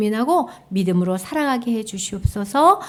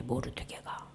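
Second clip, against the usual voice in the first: whispered name; 12 semitones lower, 21 dB quieter than usual. Shown as a demonstration. 모르드게가 whispered to the children. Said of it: "모르드게가 절을 안 해서".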